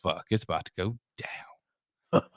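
hushed unvoiced whisper; whispered thought. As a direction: breathe it out, scared. Retelling scared inner thought.

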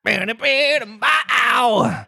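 high, slow, worried crooning; anxious jazz scat. A narrator's reading. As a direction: croon the question nervously. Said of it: "asked the Mock Turtle a little anxiously".

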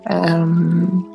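ordinary talk, nothing marked

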